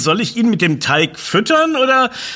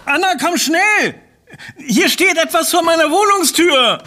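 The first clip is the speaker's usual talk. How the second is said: excited call; 8 semitones higher than usual. Acted. Calling friend to the door.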